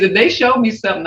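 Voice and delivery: normal talking voice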